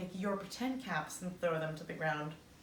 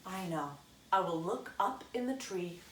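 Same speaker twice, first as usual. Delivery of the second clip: usual speaking voice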